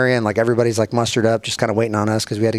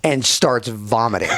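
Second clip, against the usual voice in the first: normal talking voice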